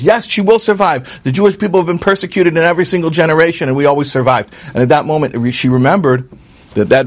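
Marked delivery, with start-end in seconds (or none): 0.0-4.5 defiant mother. Retold or imitated. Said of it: "The mother says".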